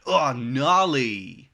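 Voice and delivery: drawn-out, loud, slow surfer-dude announcer; surfer narrator. Retold or imitated. Quoting the game's narrator to a friend, slow loud surfer drawl. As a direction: stretch gnarly out like a stoked surfer narrator. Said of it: "And then the narrator says".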